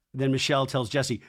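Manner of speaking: ordinary talk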